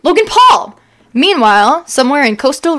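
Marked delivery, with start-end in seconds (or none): 0.0-0.8 higher, louder surprised gasp of the name; hammed-up shocked cry. Reading fan fiction to a friend, hammed-up shock. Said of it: "went over to it and gasped".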